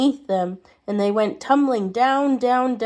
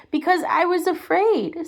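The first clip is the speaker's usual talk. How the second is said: mild sobbing; 7 semitones higher than usual. Acted. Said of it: "sobbed the little man".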